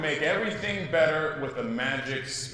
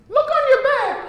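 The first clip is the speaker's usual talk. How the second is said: squeaky high, loud fairy voice; 9 dB louder than usual. Acted. Teacher voicing the fairy to her class, high and bright. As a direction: pitch way up into a tiny fairy. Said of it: "Or, excuse me".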